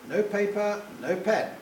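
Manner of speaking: ordinary delivery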